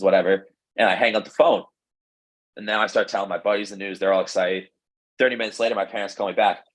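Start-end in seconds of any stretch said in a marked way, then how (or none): none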